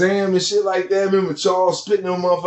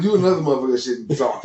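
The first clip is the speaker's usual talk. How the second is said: normal talking voice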